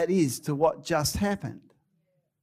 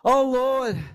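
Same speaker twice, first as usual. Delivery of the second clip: Elijah's lament, higher and higher, ending in a cry; anguished Elijah; 6 semitones higher than usual. Acted. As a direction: plead like a hunted prophet. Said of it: "He says".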